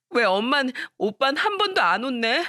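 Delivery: high, whiny, tearful pleading; a hurt girl begging for justice; tearful daughter. Retold or imitated. Hurt daughter tearfully begging her mother to scold her brother. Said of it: "엉엉 울면서 이야기를 했다고".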